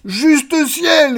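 very high, loud cry of alarm; terrified scream. Acted. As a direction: scream it in comic terror. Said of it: "hurla-t-il". (hurlement aigu de panique)

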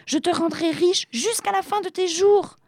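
very high, desperate little voice; pleading. A narrator's reading. Trapped genie offers riches to fisherman, pleading. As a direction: bargain for mercy up high. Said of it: "supplia le génie".

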